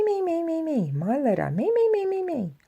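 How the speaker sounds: high, sing-song me me me; mock the child's selfishness. Grinch mocks the selfish Who child.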